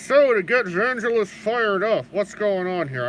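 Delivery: talking around a paintbrush in mouth; muffled, brush held in teeth. Real, not acted. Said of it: "I got a paintbrush in my mouth".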